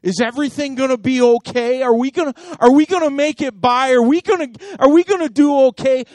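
building from plain to loud, high worry; mock panicked worrying; anxious worrier. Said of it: "You got a scarcity mentality".

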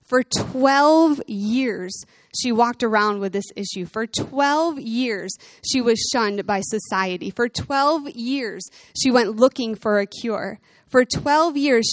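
drawn out, stressing twelve; slow emphatic repetition. Acted. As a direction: hammer home "For twelve years" slowly. Preaching repetition.

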